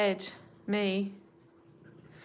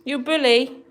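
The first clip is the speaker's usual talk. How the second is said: loud teasing; 5 semitones higher, 8 dB louder than usual. Real, not acted. Teasing the bullying cat.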